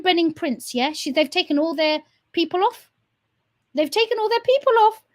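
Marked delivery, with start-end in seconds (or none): 3.7-5.0 mocking high repeat. Real, not acted. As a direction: repeat it much higher and louder, in disbelief. Mocking repeat of previous line.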